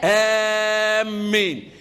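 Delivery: آمين with the madd held long and slow. Shown as a demonstration. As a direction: stretch the vowel in آمين well out. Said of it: "عندي آمنوا وإيه؟".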